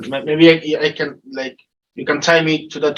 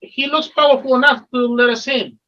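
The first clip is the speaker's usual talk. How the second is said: farmer voice; 7 semitones higher than usual. Acted. Farmer voice continues.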